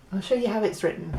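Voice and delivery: ordinary delivery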